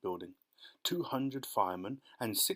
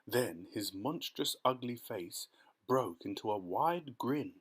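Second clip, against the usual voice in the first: plain reading voice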